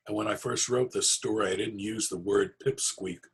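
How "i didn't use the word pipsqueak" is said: plain reading voice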